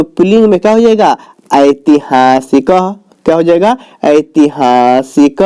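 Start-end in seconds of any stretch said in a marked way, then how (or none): 1.5-2.7 drawn-out, lower ऐतिहासिक; slow model pronunciation. Shown as a demonstration. Teacher giving the class the masculine form, slowly sounded out. 4.0-5.4 drawn-out, lower ऐतिहासिक; slow model pronunciation. Shown as a demonstration. Teacher giving the class the masculine form, slowly sounded out. Teacher repeats the derived word.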